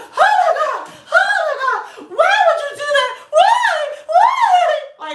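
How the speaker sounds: shrill high frantic shrieking, near tears; dramatic panicked lady. Retold or imitated. Lady screaming at the narrator over the prank. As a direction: shriek it like a hysterical coworker. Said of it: "so freaking dramatic, so dramatic".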